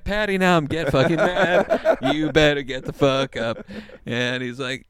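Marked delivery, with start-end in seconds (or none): 0.0-3.7 loud, high laughing scold; half-laughing fury at a friend who won't get up; mock angry yell. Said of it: "I was like".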